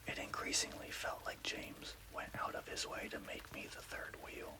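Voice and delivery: plain reading voice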